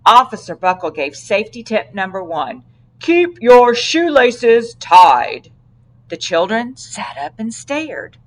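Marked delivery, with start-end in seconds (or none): 3.0-5.5 very slow, louder lecturing; slow emphatic tip. Acted. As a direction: spell out the tip to kids. Officer giving safety tip.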